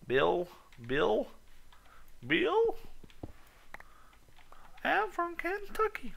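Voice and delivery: calling Bill in a high, hammed-up Kentucky twang; mock Kentucky accent. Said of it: "my awesome accent".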